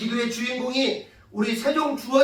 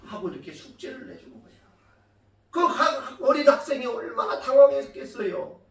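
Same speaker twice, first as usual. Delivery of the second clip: ordinary delivery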